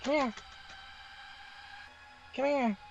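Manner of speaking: high sing-song call to a pet; sweet high pet-calling voice; cooing at a cat. Real, not acted.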